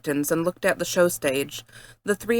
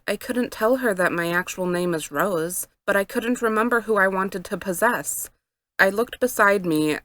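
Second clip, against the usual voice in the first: plain reading voice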